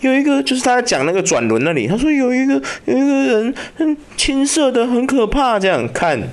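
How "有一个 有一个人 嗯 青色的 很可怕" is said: put-on high, hesitant child voice; scared little boy. Son telling dad what he sees, high frightened child voice. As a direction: imitate a small kid haltingly reporting something frightening. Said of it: "他那个小朋友就讲说".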